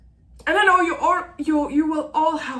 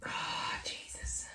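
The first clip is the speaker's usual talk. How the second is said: whispered exasperation, much softer; breathy whisper; 15 dB quieter than usual. Real, not acted. Writer fighting tears on camera, whispering to herself. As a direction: whisper it slowly to yourself, holding back tears.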